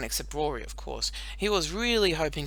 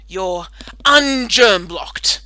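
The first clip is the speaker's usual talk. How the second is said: dismissive; 4 semitones higher, 13 dB louder, much slower than usual. Acted. Tamara dismissive, slow.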